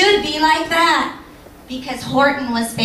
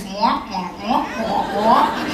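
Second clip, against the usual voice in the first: Charlie Brown teacher wah; 3 semitones lower than usual. Retold or imitated. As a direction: imitate the muffled adult voice from Charlie Brown. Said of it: "feeling like Charlie Brown".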